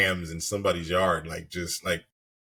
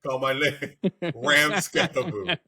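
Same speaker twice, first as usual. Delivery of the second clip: laughing through the name; slow, laughing announcement; 5 semitones higher, slower than usual. Real, not acted. Naming the goat, laughing.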